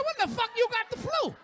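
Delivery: high, loud disbelieving shout; loud disbelief at wife's excuse; incredulous shout. Retold or imitated. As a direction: yell it in disbelief. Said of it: "I said".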